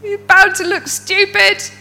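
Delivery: very high, loud inner critic; loud imposter voice. Acted. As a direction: shriek the warning as a panicky inner voice. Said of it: "when it lurks up and goes".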